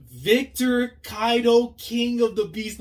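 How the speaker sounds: high, slow disbelieving cry; stunned outcry. Real, not acted. Reacting to Kaido beating Luffy, high disbelieving outcry. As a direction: cry out the villain's win in disbelief.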